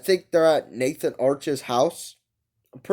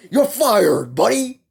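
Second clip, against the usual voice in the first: raised, high-pitched boss outburst; mock firing; 7 semitones higher, 5 dB louder, slower than usual. Boss sacks his employee, loud mock outburst. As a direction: punch it out loud, pitch jumping up on the end. [name] fires Evan in acted dialogue.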